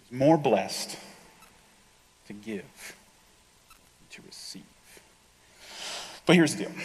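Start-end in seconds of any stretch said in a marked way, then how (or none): 0.0-4.6 fading from soft into a near whisper; slow-paused, low and hushed; hushed reverence. Real, not acted. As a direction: let it sink in, trailing down to a whisper. Savouring a verse in hushed close.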